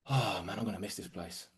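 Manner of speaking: low, soft private thought; wistful. Retold or imitated. Worker looking round the shop floor, quietly wistful. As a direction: sigh it out quietly to yourself.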